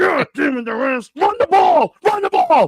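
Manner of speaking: high-pitched mock shout at the game; yelling football fan. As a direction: holler like an exasperated fan watching on a phone.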